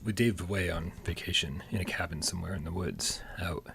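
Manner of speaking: hushed whisper, playful secret. Pretend. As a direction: whisper it like gossip. Hushed joke that host is absent.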